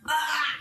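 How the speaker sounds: short, high cry of pain. Acted. Game master voices the stabbed demon, pained cry. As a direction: cry out as if run through. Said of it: "you can see the pain in his face".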